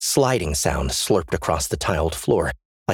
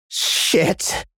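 panicked curse; 9 semitones higher than usual. Acted. Trapped backstage.

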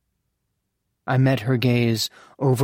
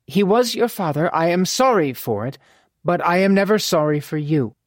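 raised-pitch mother character voice; sincere, firm mother; 5 semitones higher than usual. Acted. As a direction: console a son firmly in a higher lady's voice.

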